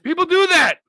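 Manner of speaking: insist, voice jumping up; high, loud protest; high insistent. Real, not acted. Insisting in argument.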